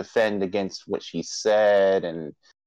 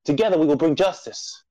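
higher-pitched, portentous proclamation with long pauses; rally the nation like a solemn show herald; grand announcer intro; 5 semitones higher than usual. Acted.

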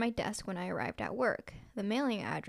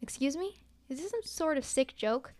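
higher, affronted questioning with little force; mildly indignant hiss; 5 semitones higher than usual. A narrator's reading. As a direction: challenge the caller in mild offence. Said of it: "the voice hissed".

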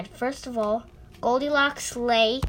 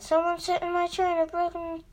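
put-on much higher little baby bear voice, a touch tearful; baby bear crying; 6 semitones higher than usual. A narrator's reading. Baby bear finds his chair broken, high mild crying. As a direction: play the tiny bear upset about his chair. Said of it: "And the baby cried".

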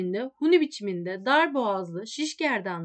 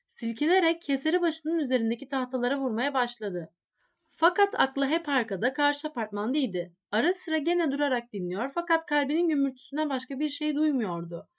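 usual speaking voice

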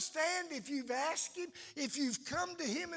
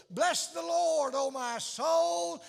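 stirred-up praise; 3 semitones higher, 7 dB louder, slower than usual. Pretend. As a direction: stir up the innermost man and call it out. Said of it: "I want my innermost man to say".

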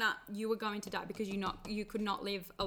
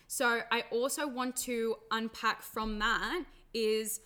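ordinary talk

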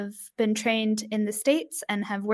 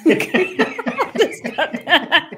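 laughing; 8 semitones higher, 6 dB louder, much slower than usual. Real, not acted. Laughing after a flubbed line.

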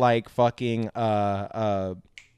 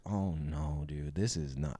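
much lower, much softer dismay; appalled hush; 9 semitones lower, 13 dB quieter, slower than usual. Retold or imitated. Retelling his reaction to the display, hushed dismay. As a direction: drop it low like you can't believe it. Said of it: "I was just like".

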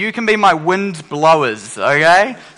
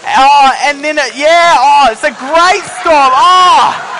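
excited; 10 semitones higher, 5 dB louder, slower than usual. Real, not acted. Kids' storm noise peaks.

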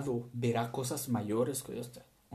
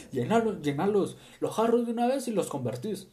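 mock eager urging; 8 semitones higher, 4 dB louder than usual. Mock servant urging Jesus.

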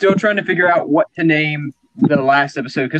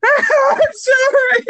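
much higher, slow, sobbing sorry; mock crying apology; much slower than usual. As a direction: blubber the apology like a wreck. Timid employee in office improv.

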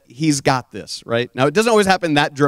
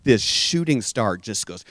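ordinary talk, nothing marked

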